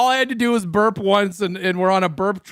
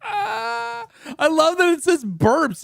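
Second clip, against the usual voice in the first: laughing; 11 semitones higher, much slower than usual. Real, not acted. Laughing at AI reading burp.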